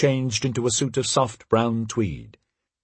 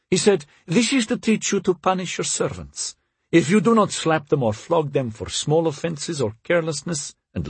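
higher-pitched character voice for the wife; haughty Russian princess; 6 semitones higher than usual. A narrator's reading. Wife justifying harshness to her husband, haughty and unrepentant. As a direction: recount it as the unrepentant Russian mistress.

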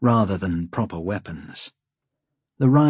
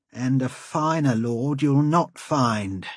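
ordinary delivery, nothing marked

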